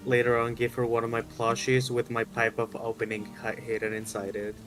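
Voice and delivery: usual speaking voice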